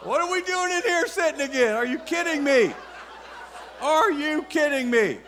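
high-pitched mock disbelief; incredulous evangelist. Preacher imitating evangelists sick of sitting again, incredulous. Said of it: "they're like".

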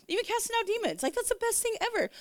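high-pitched excited delight; gushing enthusiasm. Real, not acted. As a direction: gush about it, voice way up high. Gushing about what she loves.

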